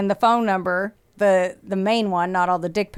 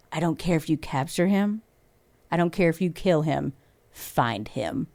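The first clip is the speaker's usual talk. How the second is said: lightly imitated cold calm, dropping lower and softer on find him; chilling suspect quote; 4 semitones lower, 4 dB quieter than usual. Host imitates husband's words to detectives leaving the station, cold and hushed. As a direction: echo his eerie parting line without shouting. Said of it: "And he said it just like that".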